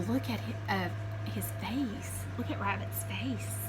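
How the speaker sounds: ordinary delivery, nothing marked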